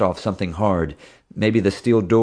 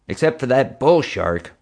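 lightly voiced stern woman, higher; a hint of a higher character voice; Marge; 5 semitones higher than usual. A narrator's reading.

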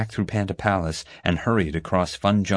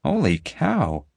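stunned holy cow; 7 semitones higher, much slower than usual. Acted. Boy stunned by panda poop size.

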